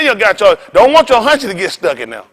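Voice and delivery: ordinary delivery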